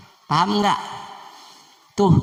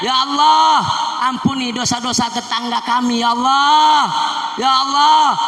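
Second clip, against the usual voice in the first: Ya Allah cried out loud and high at each line; shouted prayer; 9 semitones higher, 5 dB louder than usual. Acted. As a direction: pray at the top of your voice, pleading. Prayer at full force.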